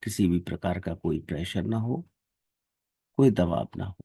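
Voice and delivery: ordinary talk, nothing marked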